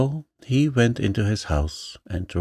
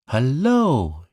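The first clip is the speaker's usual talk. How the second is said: higher, bright happy greeting call; joyful shout; 5 semitones higher, 3 dB louder than usual. A narrator's reading. Pooh greeting Christopher Robin, joyful shout. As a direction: greet a friend with delight. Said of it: "he shouted joyfully".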